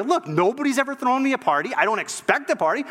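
high-pitched, mildly exasperated protest. Acted. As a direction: protest defensively, voice climbing high. Acted quarrel over birthday party.

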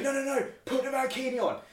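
high-pitched mock yell of a drunk lad; hammed-up heckle from a rowdy fan; drunk hooligan yelling. Retelling fans pressuring her to wear mankini, mock yell. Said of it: "absolutely yelling at you".

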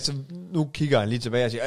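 normal talking voice

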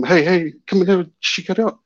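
high, quick mock yell without volume; mild reenacted yell; retold yelling out. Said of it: "I'm yelling out to him".

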